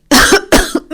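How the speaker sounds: a hoarse dry cough; dry cough fit. A narrator's reading. Widow chokes on a dry coughing fit. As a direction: cough it out dryly. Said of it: "ભાનુમતીને ઉધરસ ચડી".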